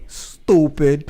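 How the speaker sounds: drawn mocking "Stupid"; stretched "Stupid"; stooopid. Shown as a demonstration. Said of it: "spelling it S T O O P I D".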